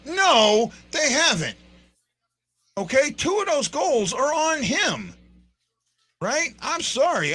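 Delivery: high, insistent rebuttal, loud on the no; emphatic disagreement. Real, not acted. Host rebutting fans who defend the goalie, emphatic disagreement. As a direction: push back hard, pitch up high.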